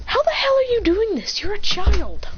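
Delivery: high-pitched disbelieving demand; astonished outburst. Acted. Endeavor baffled by a child, high astonished outburst. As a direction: exclaim it in amazement. Father amazed at child's skill.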